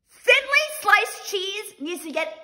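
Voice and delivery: high, slow, emphatic outrage; mock outraged verdict. Vlogger banishing thinly sliced cheese, high mock outrage. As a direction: draw it out as a final verdict.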